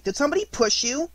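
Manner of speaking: high-pitched eager question; small, excited squeaky voice; excitedly. A narrator's reading. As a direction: ask like a thrilled child. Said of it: "said Roo excitedly".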